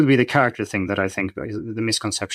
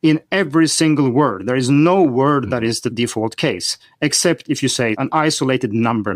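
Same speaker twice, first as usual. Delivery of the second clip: normal talking voice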